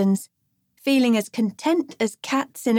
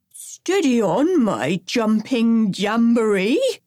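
cautioning granddad; slower than usual. Acted. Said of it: "cautioned Professor Gramps".

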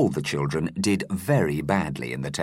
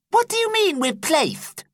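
put-on very high little girl voice; a nervous girl questioning her teacher; schoolgirl asking; 3 dB louder than usual. A narrator's reading. Lucasta asking her teacher in a very high girlish voice. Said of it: "asked Lucasta Smirk".

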